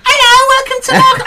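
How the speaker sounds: hammed-up posh checkout greeting; celebrity checkout voice. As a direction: squeal it out like a star greeting shoppers. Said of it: "She was like".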